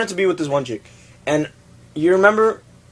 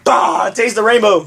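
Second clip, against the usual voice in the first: high-pitched, hammed-up ad slogan; playful, raised-pitch exclamation; mock slogan shout; 8 semitones higher, 4 dB louder, slower than usual. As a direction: belt it out like a joke commercial. Acting out an imagined Skittles slogan.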